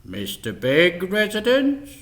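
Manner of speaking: a hint of a stiff butler on the intercom; lightly voiced butler. A narrator's reading. Butler answering the buzzer, formal.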